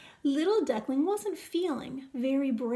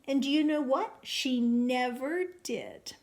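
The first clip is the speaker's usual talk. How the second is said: ordinary delivery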